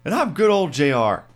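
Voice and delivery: hammed-up bad J R voice; terrible J R impression. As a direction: do a bad old announcer. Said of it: "That, that was terrible".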